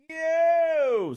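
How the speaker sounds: a long, high, sung-out yo; stretched high "Yo!" to kick things off; excited shout-out. Real, not acted. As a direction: call it out like a hype man. Calling out to open segment.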